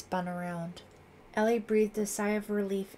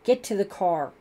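loud, impatient order; impatient scream; 9 dB louder than usual. A narrator's reading. Lieutenant sends girls away, impatient shout. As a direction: shout them away without a full scream. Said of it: "screamed impatiently".

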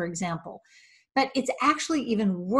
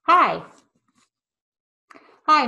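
Hi. spoken from behind a face mask; muffled through a mask; 6 semitones higher, 8 dB louder than usual. Shown as a demonstration. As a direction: let the mask dull the greeting. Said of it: "Just listen".

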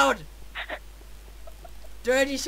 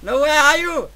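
ordinary talk

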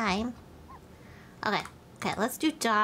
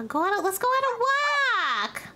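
raised excited puppy voice; high eager dog voice; 10 semitones higher, 4 dB louder, much faster than usual. Acted. Streamer voicing the dog wanting a walk, high eager. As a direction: sound like an eager pup.

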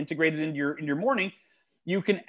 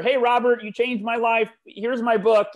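nerdy fan gushing; 5 semitones higher than usual. Retold or imitated. Author handing a hero his book, higher eager fan voice. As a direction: gush at your hero like a nerdy superfan. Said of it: "this nerdy self-published author".

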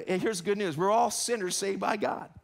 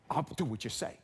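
sullen compliance; 7 semitones lower, 5 dB quieter than usual. Acted. Voicing sullen employee.

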